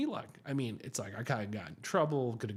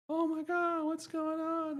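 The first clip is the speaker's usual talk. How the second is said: put-on much higher, startled young woman's cry; shocked girl; slower than usual. Girlfriend finding spit on the car, much higher shocked voice. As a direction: squeal it like she just found the mess. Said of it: "girl comes out".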